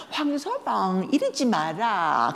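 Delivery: put-on slow, bashful old mother voice; drawn-out pleased scolding; pleased mother shooing off son-in-law; mother's coy protest.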